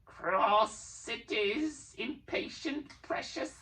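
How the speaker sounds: high, very slow creature hiss; high drawn-out creature hiss; Gollum hissing. A narrator's reading. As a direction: taunt the impatient guest. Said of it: "hissed Gollum".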